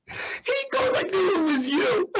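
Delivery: high, laughing through the words; breaking into high laughter mid-quote. Retold or imitated. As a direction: crack up while quoting him. Dean laughing retelling the exchange.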